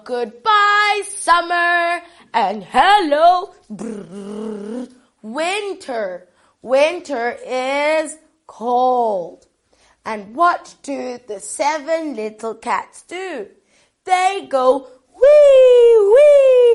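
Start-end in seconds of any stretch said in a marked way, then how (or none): 3.7-4.9 soft shivering lips; quiet teeth-chattering brrr; cold shiver brrr. Acted. Shivering at winter. 15.2-16.7 much higher, louder squeal; joyful skating squeal; gleeful wee. Acted.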